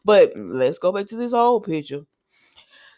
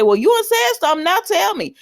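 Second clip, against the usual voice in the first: high, quick, louder prodding; insistent demand; 10 semitones higher, 4 dB louder than usual. Retold or imitated. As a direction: press for the secret, pitched up. Acts out pressing her to tell.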